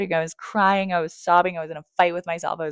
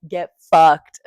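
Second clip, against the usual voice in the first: louder, flat snapped curse; 12 dB louder than usual. Retold or imitated. Shouting at God in a hard place, loud curse. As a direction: spit it at God.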